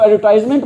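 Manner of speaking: एडवर्टाइजमेंट with "tize" rhyming with "eyes"; common mispronunciation. Shown as a demonstration. Teacher showing how many people say it, एडवर्टाइजमेंट with a long "eye" sound. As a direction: say the third syllable of एडवर्टाइजमेंट as "tize". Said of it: "बहुत सारे लोग इसको".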